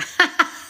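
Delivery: short high cackle; real gleeful cackle. Real, not acted. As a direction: burst into a wicked little cackle. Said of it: "The cackle".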